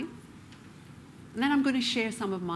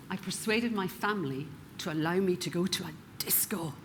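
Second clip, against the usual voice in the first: whisper; 5 dB quieter than usual. Retold or imitated. Confiding aside to audience.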